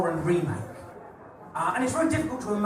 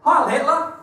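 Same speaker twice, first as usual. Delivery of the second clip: much higher, loud barked salute; mock Nazi salute; 8 semitones higher, 5 dB louder than usual. Comic greeting the crowd with a mock Nazi salute, loud and high. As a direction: bark it like a parade-ground greeting.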